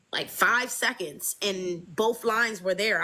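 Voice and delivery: normal talking voice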